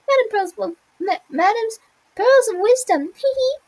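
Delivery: high, eager little voice; squeaky Spoolie. Acted. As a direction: plead kindly in a high squeak.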